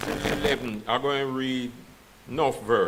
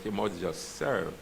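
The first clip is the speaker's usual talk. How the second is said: quiet insistence; 5 semitones lower, 7 dB quieter than usual. Shown as a demonstration. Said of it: "You understand?".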